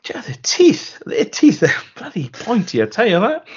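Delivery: high-pitched, hammed-up alarm; mock panicked captain. Captain warning his video log about pointy teeth, mock panic.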